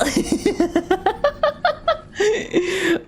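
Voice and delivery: long giggling laugh. Real, not acted. Laughing after the nerd voice.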